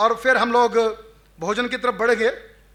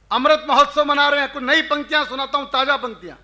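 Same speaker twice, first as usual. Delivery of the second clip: ordinary talk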